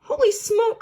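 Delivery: high, loud alarmed cry; a team shocked to see the Swamp; cried out. A narrator's reading. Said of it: "cried the team".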